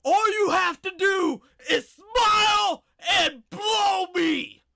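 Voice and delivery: high, loud, slow screaming rant; furious shout. Retold or imitated. Husband yelling at his wife over the phone, furious slow shout. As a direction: scream it like a man losing it on the phone. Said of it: "he would be like".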